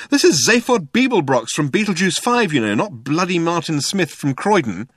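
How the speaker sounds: high-pitched indignant protest; indignant demand. A narrator's reading. Ford resents ignorant Arthur, demanding indignantly. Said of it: "he demanded".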